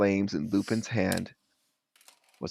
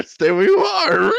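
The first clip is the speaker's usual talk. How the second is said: high, loud hammed-up dog bark of a line; 10 semitones higher, 8 dB louder than usual. Lupin warning the students in a hammed-up dog voice. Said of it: "I'm gonna go with dog voice".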